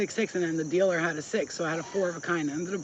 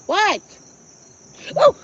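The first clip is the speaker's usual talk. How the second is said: sudden high loud "what"; startled shriek; 13 dB louder than usual. Real, not acted. Vlogger spotting a bee on her foot, startled shriek. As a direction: jump at something on your foot.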